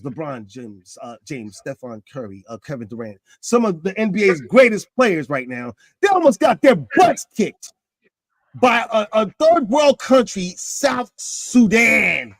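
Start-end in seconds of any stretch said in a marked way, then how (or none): 6.0-12.3 higher, louder, slowing down to the punchline; incredulous emphasis. Real, not acted. Host stunned, telling listeners about the near upset. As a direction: build up to it in disbelief. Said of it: "I am dead serious".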